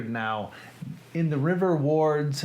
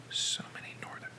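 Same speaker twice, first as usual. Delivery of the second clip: whisper; 12 dB quieter, faster than usual. Real, not acted. Reading names aloud to himself.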